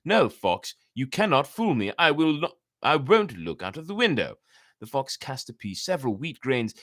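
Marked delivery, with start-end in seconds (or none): 0.1-4.4 louder, raised defiant no; defiant refusal. Acted. The cock refuses to look out at the fox, defiant and raised. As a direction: refuse him firmly, standing your ground.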